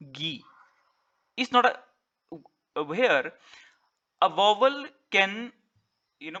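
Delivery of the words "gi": "Gi" said softly on its own, unstressed; weak syllable demo. Shown as a demonstration. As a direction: let "Gi" drop low in volume as the weak syllable. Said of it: "weak syllable".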